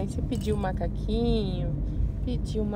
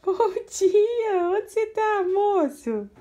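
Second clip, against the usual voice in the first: much higher and louder, delighted; 9 semitones higher, 5 dB louder, slower than usual. Real, not acted. Mãe animada com bebê. (mãe animada com o bebê, voz bem aguda e alta)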